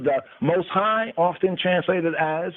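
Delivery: ordinary talk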